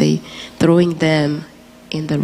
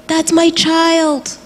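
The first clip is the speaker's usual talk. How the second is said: put-on mother screaming for her child, high and drawn out; retold scream; 12 semitones higher, much slower than usual. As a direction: draw out the claim like a desperate scream. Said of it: "she's screaming and she said".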